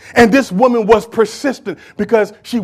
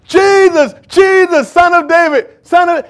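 crying out; 8 semitones higher, 4 dB louder, slower than usual. Acted. Blind man crying out.

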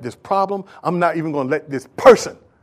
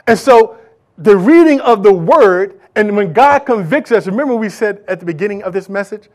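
normal talking voice

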